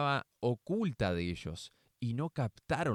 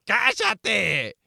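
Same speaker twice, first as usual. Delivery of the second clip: high, loud scolding shout; crowd shouting; 9 semitones higher, 10 dB louder than usual. Acted. Crowd shouting at the blind beggar, loud and harsh. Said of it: "le gritaba la gente".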